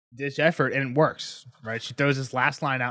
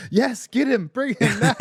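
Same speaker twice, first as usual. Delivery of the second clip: loud, high-pitched exasperated order; exasperated shout; 10 semitones higher, 6 dB louder, slower than usual. Acted. Master orders his confused squire, exasperated shout. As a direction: throw up your hands and yell it.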